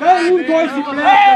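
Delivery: loud coaching yell; shouted instruction. Real, not acted. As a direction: shout the move out to the players. Coach calling orders to players. (instrucțiune strigată tare)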